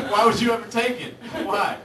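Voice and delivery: ordinary delivery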